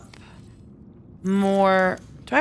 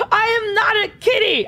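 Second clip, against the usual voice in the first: mock indignant; 11 semitones higher, 3 dB louder than usual. Chat calls her a kitty.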